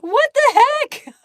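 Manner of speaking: can't believe what just happened; loud, high-pitched amazed exclamation; delighted disbelief. Real, not acted. Reacting to surprise gift.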